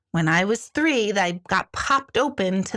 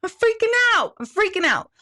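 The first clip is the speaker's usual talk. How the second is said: mock panic; 11 semitones higher than usual. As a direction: squeal it like mind in a panic. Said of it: "they're like".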